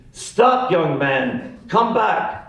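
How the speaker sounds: put-on high-pitched German-accented screech; Colonel Klink. Old man calling after fleeing boy, German-accented screech. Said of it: "I heard him screech".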